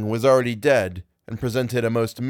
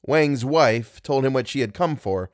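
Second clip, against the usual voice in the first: ordinary delivery, nothing marked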